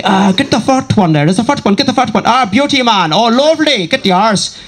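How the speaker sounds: high, gleeful Northern Irish shooter; Northern Irish accent. Retold or imitated. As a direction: mimic a Northern Irishman picking out targets with relish. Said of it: "These were three Northern Irish".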